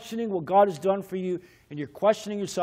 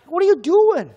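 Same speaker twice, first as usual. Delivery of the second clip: high, loud, baffled demand; startled challenge; 12 semitones higher, 9 dB louder than usual. Acted. Officer to the driver cleaning his shoes, startled. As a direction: call out in alarmed disbelief.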